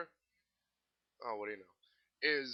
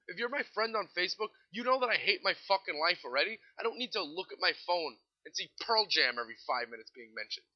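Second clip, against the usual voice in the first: ordinary delivery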